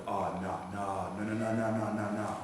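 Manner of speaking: low, soft string of repeated refusals; muttered dismay tumbling out quietly; hushed dread. Acted. Satan hears the shofar up in Shemayim, hushed dismay.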